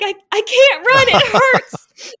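high, loud, crying wail; sobbing protest. Retold or imitated. Exhausted runner cries to her friend that it hurts, high, loud wail. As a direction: wail it out through sobs.

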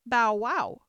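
loud, eager daisy cry. A narrator's reading. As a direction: call it out proudly. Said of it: "cried a daisy".

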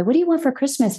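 high, bouncy imitation of an eager husband; joyful question. Husband asks his wife what she wants, imitated joyful lilt. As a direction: lift it up with delight. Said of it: "He would say that with such joy".

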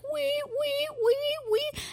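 very high, thin siren wail; alarm noise. Retold or imitated. The store alarm goes off as she runs, very high siren wail. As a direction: imitate a shop security alarm. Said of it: "the security alarm would go off like".

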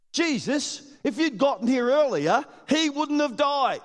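high, pleading lament; pleading sisters. Retold or imitated. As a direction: beg him, a little reproachful. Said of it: "say this".

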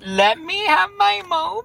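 high whiny protest; high playful whining; mock whine. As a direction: plead like a spoiled kid. Friend teasing her crush list.

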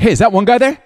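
high, loud, mock-jealous questioning; a hammed-up jealous husband probing; mock suspicious husband, pitched high. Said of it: "I was getting suspicious".

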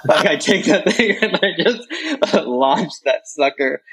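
laughing while telling the throw; breaking into laughter mid-sentence; laughing through it. Real, not acted.

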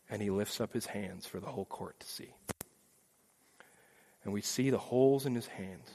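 very soft, low, tender verdict; solemn declaration. Pretend. Jesus declares the child not guilty, soft and solemn. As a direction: quietly announce the victory.

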